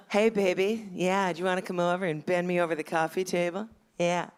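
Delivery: mock seductive, half-hearted; sultry phone sex voice. Lightly voiced middle-aged phone sex operator to a caller.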